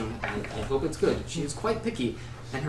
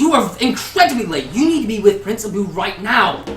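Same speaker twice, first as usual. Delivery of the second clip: high, loud, scolding cry of a gnome; excited gnome shout; 8 semitones higher, 11 dB louder than usual. Acted. Gnome scolds the late bard, high and shouting.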